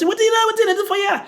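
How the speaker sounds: high, loud mock yell; mock shout. Food seller yelling at the man under the tree, high mock shout. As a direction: yell it like the angry roadside seller. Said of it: "she shouts".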